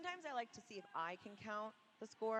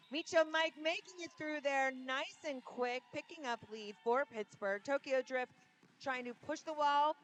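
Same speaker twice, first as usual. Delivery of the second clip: raised, quickening action call; live play-by-play; 8 semitones higher, 6 dB louder than usual. Real, not acted. Announcer follows a jammer pushing the wall, excited play-by-play. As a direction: build excitement as the skater breaks through.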